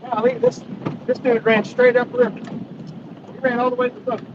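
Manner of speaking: excited gasps during the fight; fighting a fish. Real, not acted. Fish runs toward boat.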